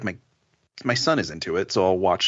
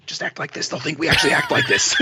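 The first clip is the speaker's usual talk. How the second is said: starts hushed, then breaks into high squealing laughter; hushed scheming that bursts into high laughter; laughing mock instruction. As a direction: whisper the plan, then crack up high. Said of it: "and just like".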